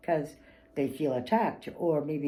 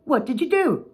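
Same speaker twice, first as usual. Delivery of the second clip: high, louder accusing phrase; the boss's usual blaming question; boss's stock phrase; 9 semitones higher, 6 dB louder than usual. Retold or imitated. Boss's habitual blame, high and accusing. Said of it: "very common words, the phrase".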